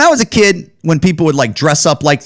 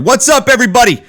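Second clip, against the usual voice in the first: higher, louder and fast, like a show intro; hyped greeting; 11 semitones higher, 3 dB louder, faster than usual. Real, not acted. Vlogger greeting viewers on return, higher, louder, fast. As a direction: fire it off as a big channel opener. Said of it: "I don't know why I just said".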